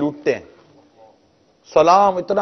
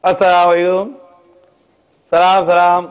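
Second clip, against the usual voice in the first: mock careless salaam; 5 dB louder than usual. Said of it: "ملاقات ہوتی ہے تو ہم کیا کرتے ہیں".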